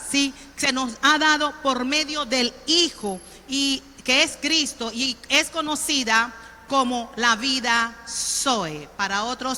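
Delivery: ordinary talk, nothing marked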